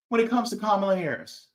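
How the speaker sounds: ordinary talk, nothing marked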